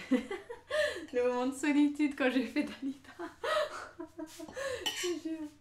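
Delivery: high, breathless laughter through words; giggling through the words; laughing. Real, not acted. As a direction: laugh helplessly as you speak. Laughing at own [name] attempt.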